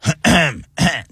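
imitated congested throat clearing; short rough ahem. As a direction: hack to clear phlegm. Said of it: "not even coughing".

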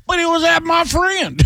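high, slow mock innocent protest; a hint of a baffled old country guy; imitating old Cooper. Old gambler defending himself, mock innocent voice. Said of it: "And he goes".

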